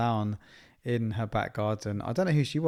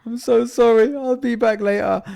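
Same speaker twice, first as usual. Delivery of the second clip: higher, louder, hammed-up anguished apology; distraught apology; 11 semitones higher, 8 dB louder than usual. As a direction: plead like a dad tearing himself away. Dad leaving crying toddler at nursery.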